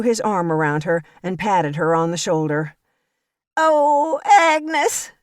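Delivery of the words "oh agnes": high, loud theatrical wail; Violet crying out. A narrator's reading. Violet calls out to Agnes in front of the church, high fake sobbing. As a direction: voice Violet as a high fake-weepy old lady. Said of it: "she cried in a loud voice".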